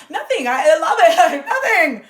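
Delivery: high, loud laughing denial; laughing mock denial. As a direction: laugh it off, brushing the question away.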